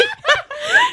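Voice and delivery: high shrieking laugh breaking out; burst of laughter. Real, not acted. Friend laughing hard while telling the story. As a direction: laugh helplessly mid-story.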